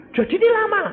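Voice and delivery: loud, high-pitched disbelief; mock dismayed question. Questioner asks pastor about the wait, loud high dismay. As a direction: ask loudly in a high voice.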